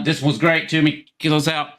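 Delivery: normal talking voice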